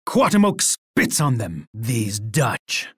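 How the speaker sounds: normal talking voice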